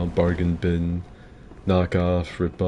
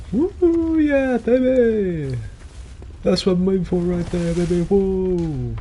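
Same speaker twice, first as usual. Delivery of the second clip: hype streamer impression; 11 semitones higher, slower than usual. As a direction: whoop it up like an over-excited streamer. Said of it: "Was, uh".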